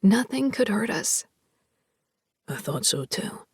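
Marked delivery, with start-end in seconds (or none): 2.5-3.5 low hushed whisper. A narrator's reading. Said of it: "Rye whispers".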